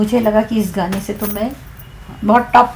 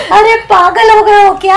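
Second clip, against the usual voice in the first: high, loud, laughing half-sob; laughing dialogue take; 9 semitones higher, 5 dB louder than usual. Shown as a demonstration. Singer demonstrates her third take, laughing and high. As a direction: laugh through the line as if bashful. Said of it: "तीनों चीज मैंने अलग-अलग बोले।".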